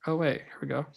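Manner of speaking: ordinary talk, nothing marked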